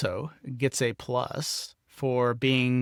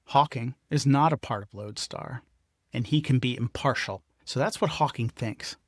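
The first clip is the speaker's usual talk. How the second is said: ordinary talk, nothing marked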